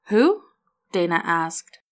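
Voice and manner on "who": high, loud startled question; sudden puzzled who; surprised. A narrator's reading. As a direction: caught off guard by a name. Surprised at an unknown name.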